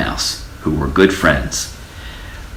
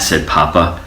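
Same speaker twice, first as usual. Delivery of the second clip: plain reading voice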